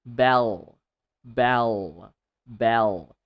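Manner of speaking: low, firm "bell", each one separate and falling; deliberate single-word drill; repeat-after-me model. Shown as a demonstration. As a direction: model "bell" for a class to copy. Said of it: "repeat after me".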